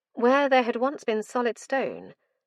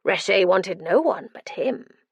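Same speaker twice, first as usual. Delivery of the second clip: loud manic madman; 7 dB louder than usual. Acted. Madman recalls Reshe.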